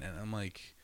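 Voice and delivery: normal talking voice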